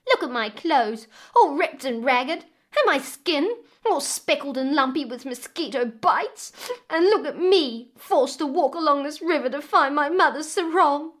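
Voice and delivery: high, loud, indignant whining tirade; snapping complaint. A narrator's reading. Stepdaughter lists her miseries to the crocodile, snapping indignantly. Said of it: "the stepdaughter snapped back at the crocodile".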